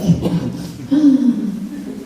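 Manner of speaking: sharp high gasp of surprise; shocked gasp. Retold or imitated. Learning her roommate likes her, a sharp gasp. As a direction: catch your breath in shock. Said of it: "And I was like".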